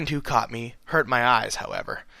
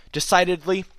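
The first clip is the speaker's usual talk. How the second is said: ordinary delivery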